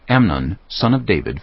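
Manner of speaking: ordinary delivery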